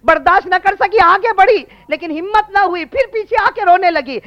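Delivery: high, forceful sermon voice; impassioned preaching. Acted. Preacher telling of Fatima watching her father, impassioned.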